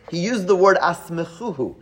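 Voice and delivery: normal talking voice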